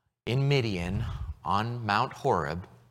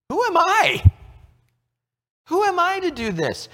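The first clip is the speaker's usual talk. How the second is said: high, loud, disbelieving Who am I; incredulous Moses; 6 dB louder than usual. Acted. Moses doubting he can face Pharaoh, high incredulous cry. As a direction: Moses balking at God's call, high and incredulous.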